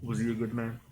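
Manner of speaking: muffled, behind a mask. Acted. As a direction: speak as if through a mask. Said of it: "a muffled voice comes from behind the mask".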